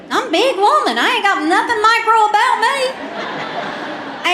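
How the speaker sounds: high, loud sassy brag, slowing at the end; sassy big woman. Retold or imitated. Answering the offended woman, a loud sassy boast.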